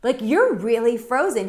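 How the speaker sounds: imitating a friend's loud, high-pitched alarm; friend freaking out. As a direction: exclaim it like a stunned buddy. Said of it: "He was freaking out".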